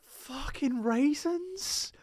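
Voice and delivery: high, slow, drawn-out disbelief; disgusted disbelief. Real, not acted. Finding raisins in his snack, high and slow in disbelief. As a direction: stretch it out like you can't believe it.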